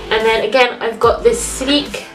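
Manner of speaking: normal talking voice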